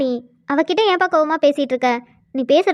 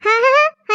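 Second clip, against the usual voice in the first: scornful laugh; 6 semitones higher, 4 dB louder than usual. Acted. Mocking laugh mid-quarrel.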